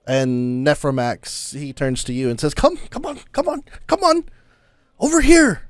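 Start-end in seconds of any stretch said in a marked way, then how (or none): 2.5-5.6 high, insistent come-on, come-on; high-pitched eager beckoning; eager old traveler beckoning. Acted. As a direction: wave them over like an excited old man. Volo beckoning Nephromax.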